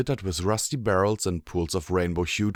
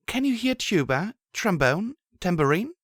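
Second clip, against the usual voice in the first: put-on higher young mare voice; Blackjack voice; 12 semitones higher than usual. A narrator's reading. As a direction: voice the heroine, higher. Blackjack asks.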